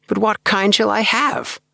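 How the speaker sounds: high, anxious, pressing question; little man anxiously demanding an answer. A narrator's reading. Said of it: "he demanded, looking at me anxiously".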